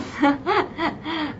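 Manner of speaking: soft, quiet chuckling; chuckle. Real, not acted. Teacher amused by a student's comment, soft chuckle. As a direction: laugh softly to yourself.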